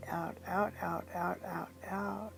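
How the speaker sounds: softening repeated outs; fading breathe-out count. Acted. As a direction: let the outs fade like a long exhale. Guiding a long exhale.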